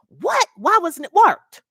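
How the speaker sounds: indignant client. Retold or imitated. Client demanding answers from the notary about an unmarked form, mock indignant. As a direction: imitate an upset client's high-pitched protest.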